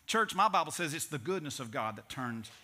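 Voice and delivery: ordinary talk, nothing marked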